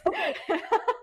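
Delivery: bright high laugh; high laughter. Real, not acted. Guest laughing about the hotel restaurant, high laughter.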